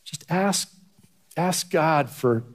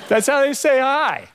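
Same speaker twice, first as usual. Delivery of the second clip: much higher, louder, hammed-up cool-guy greeting; exaggerated tough guy; 12 semitones higher, 4 dB louder than usual. Shown as a demonstration. Mocking how guys greet him on the street, hammed-up. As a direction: overplay the tough guy saying hi. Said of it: "I'm gonna exaggerate how I".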